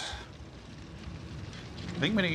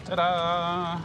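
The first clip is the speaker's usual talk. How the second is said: triumphant ta-da; 6 semitones higher, 5 dB louder than usual. Real, not acted. Reveals exactly three hundred.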